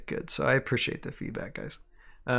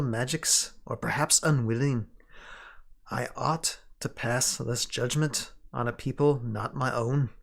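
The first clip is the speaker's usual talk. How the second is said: attempted English accent, measured and deliberate with weighty pauses; elfish English accent. Sanlin writing to Shiori of lost knowledge, in an elfish English accent. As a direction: read it as an elf with an imperfect English accent. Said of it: "sounding kind of elfish, but I can't do a good English accent".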